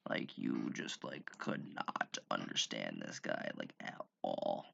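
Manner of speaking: put-on very low, soft, creaky drunken mumble; slurred low croak of a mock drunk; drunk guy impression. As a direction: imitate a barely intelligible drunk who doesn't want to be there. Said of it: "that is what this guy sounded like".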